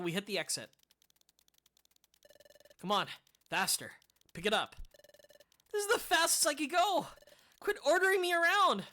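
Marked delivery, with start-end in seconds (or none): 5.7-8.8 higher and louder, fed up; indignant complaint. Acted. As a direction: push back at being bossed. Character snaps back while running.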